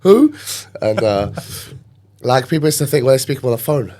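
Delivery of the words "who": high, loud startled who; a yelped, incredulous question; shocked. Retold or imitated. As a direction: blurt it out in mock alarm. Retold reaction to mum.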